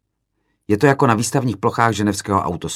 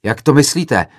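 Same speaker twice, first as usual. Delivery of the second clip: loud, higher, upset retort; agitated yell; 6 semitones higher, 7 dB louder than usual. A narrator's reading. Another student to the speaker, agitated yell. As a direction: demand an explanation, raising your voice. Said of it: "zařval rozčileně jiný student".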